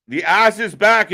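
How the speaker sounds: loud, high, rising hype call; excited announcement. Real, not acted. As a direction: sell it like a big return. Announcing a returning wrestler.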